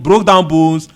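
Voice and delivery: usual speaking voice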